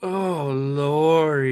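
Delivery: very slow drawn-out moan; moaning Myers. Pretend. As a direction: moan the name like a horror villain. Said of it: "hit us with the moaning Myers".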